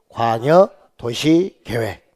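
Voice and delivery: measured classroom chant; drilled term. Shown as a demonstration. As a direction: spell it out slowly for the class to repeat. Teacher drills term for repetition.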